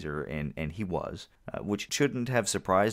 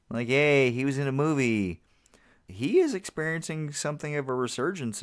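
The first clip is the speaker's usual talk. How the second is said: sarcastic; 7 semitones higher than usual. Retold or imitated. Sarcastic about mediocre movies.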